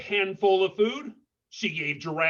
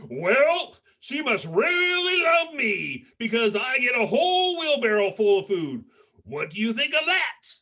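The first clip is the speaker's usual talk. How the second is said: higher, louder bragging voice; boastful giraffe; 6 semitones higher, 4 dB louder than usual. Acted. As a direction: show off, top the hare.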